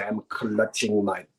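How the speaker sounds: low, slow mock Russian accent; poor Russian accent. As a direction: attempt a Russian lady's accent, drawn out. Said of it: "I can't do a Russian accent".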